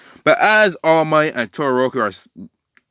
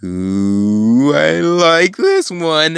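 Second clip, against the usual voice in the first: slow, drawn-out gloat sweeping upward; hammed-up gleeful villain, swooping higher; Carnage; 4 semitones higher, 4 dB louder, much slower than usual. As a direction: savor it with a twisted smile. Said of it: "this twisted smile start coming off on Carnage".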